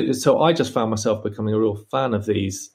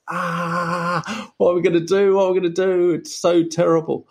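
high, panicky wail of despair; mock panic; 8 semitones higher than usual. To himself in the road with the broken guitar, high panicked wail. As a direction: throw your hands up and wail it high. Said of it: "I did one of those".

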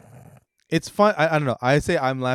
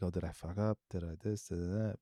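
quiet self-doubt; 4 semitones lower, 12 dB quieter, faster than usual. Retold or imitated. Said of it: "you kinda wonder".

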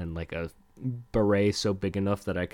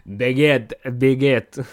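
loud, high mock-French shout; screaming French accent; 5 semitones higher, 9 dB louder, slower than usual. Podcaster screaming baguette for laughs, French accent. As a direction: yell it like a cartoon Frenchman. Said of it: "screaming baguette in a French accent".

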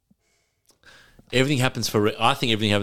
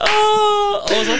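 laughing high; 4 dB louder, slower than usual. Real, not acted. Cracking up at the joke.

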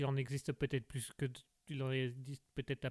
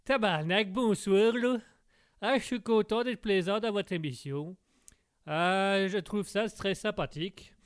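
put-on loud, higher hearty Québécois accent; 5 semitones higher, 6 dB louder than usual. Québécois guest greets the show's host, thick Québécois accent. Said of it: "monsieur le Québécois".

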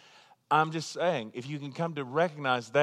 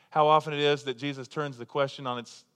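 ordinary talk

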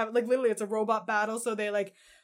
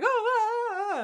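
creepy moaning; 11 semitones higher than usual. Retold or imitated. Imitating creepy man's moans.

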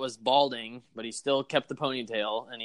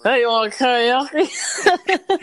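put-on drunk voice; 11 semitones higher, 9 dB louder, much slower than usual. Drunk guy offering karaoke.

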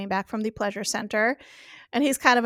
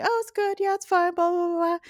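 higher, overdone polite praise; enthusiastic; 9 semitones higher than usual. Retold or imitated. Tipsy diners reassuring the waiter, hammed-up enthusiasm. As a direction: pile on the praise so nobody notices. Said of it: "kind of enthusiastically".

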